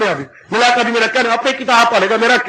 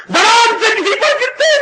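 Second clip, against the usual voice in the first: laughing shout; 11 semitones higher than usual. Real, not acted. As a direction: burst out laughing at the fall. Laughing tale of sleepwalker falling. (गिरने की बात पर हँसते हुए चीख)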